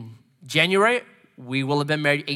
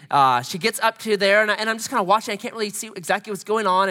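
usual speaking voice

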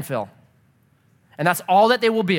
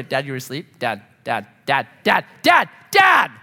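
put-on child calling Dad; 6 semitones lower, 3 dB louder than usual. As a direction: kid nagging dad awake, growing louder. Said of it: "But they do".

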